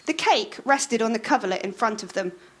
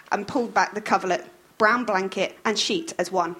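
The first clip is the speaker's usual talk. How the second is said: ordinary delivery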